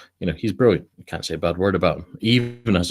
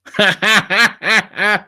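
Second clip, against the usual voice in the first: high-pitched laugh at own joke; corny dad laugh; 13 semitones higher, 6 dB louder than usual. Real, not acted. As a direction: crack up at your own pun. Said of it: "laughing at my own joke".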